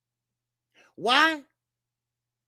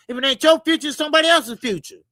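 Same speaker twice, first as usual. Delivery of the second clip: louder and quicker, pressing the point home; heated emphatic outburst; 3 semitones higher, 7 dB louder, faster than usual. Real, not acted. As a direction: hammer the point with a raised voice. Heated rant about working for future.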